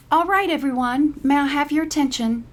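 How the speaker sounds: high, loud cheerful teacher voice; instructor's call. A narrator's reading. Art instructor calls the crowded day room to order, high and loud. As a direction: call the class to attention brightly.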